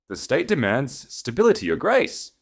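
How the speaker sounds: high, loud, pressing official; urging chancellor. A narrator's reading. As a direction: urge the duke with dry insistence. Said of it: "The High Chancellor urged".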